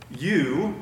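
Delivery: "You" hit hard and held alone; single punched "You", then a pause; emphatic You. Shown as a demonstration. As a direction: pastor drives home one word. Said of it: "is definitive, it's categorical, it's emphatic".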